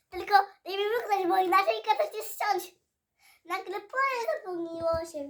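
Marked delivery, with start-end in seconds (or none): 0.1-2.7 loud, fast royal threat; queen's threat. Acted. Queen threatening Alice over croquet, loud.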